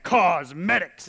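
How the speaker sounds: "cosmetics" slowly drawn out, each part weighty; grand. Shown as a demonstration. Comic showing how grand the name sounds, "cosmetics" slow and stately. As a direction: stretch "cosmetics" into something grandiose. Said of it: "It sounds so grand".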